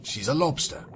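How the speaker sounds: ordinary delivery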